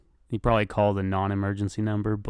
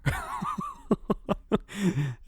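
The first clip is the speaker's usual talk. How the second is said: squealing high laugh; 4 dB quieter than usual. Real, not acted. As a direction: burst into a high giggle. After the yell.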